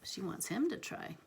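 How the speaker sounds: soft whisper. Real, not acted. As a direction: breathe it quietly. Whispered reading aside.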